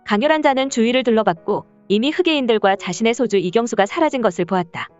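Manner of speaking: ordinary delivery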